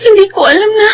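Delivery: sobbing the words to her mother; crying out. Acted. Daughter crying out on finding her mother.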